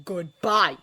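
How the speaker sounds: savage goodbye. A narrator's reading. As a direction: cut her off hard. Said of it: "said Harry savagely".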